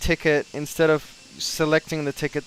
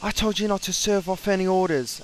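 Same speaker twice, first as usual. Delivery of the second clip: raised, pitched-up imitation of a ranting boss; mock screaming manager; 5 semitones higher than usual. Imitating the cranky manager yelling at him over a ticket. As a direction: ham up the cranky supervisor's telling-off. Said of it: "He's screaming at me".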